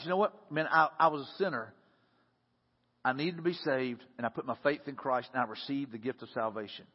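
low, softening personal testimony; quiet, humble admission; subdued confession. Acted. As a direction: confess it softly, voice sinking. Acted testimony of realizing one was sinner.

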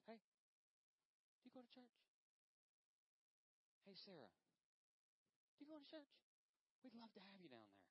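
soft, high, casual invite; gentle acted church invitation; simple friendly invitation. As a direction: invite them lightly and softly, unhurried. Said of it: "just simple words".

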